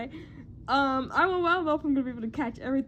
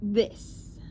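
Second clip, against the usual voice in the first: ordinary talk, nothing marked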